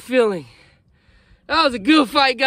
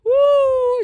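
high whooping cheer; excited shout; 14 semitones higher, 5 dB louder than usual. Real, not acted. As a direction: yell it out with joy. Just hooked a big fish.

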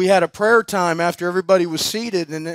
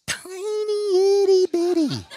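high, slow little voice for itty bitty; tiny voice; 14 semitones higher, slower than usual. Shown as a demonstration. Showing the crowd how tiny the diamond was, in a high, slow little voice. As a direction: shrink the voice down to match the tiny diamond. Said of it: "Tiny, tiny, tiny".

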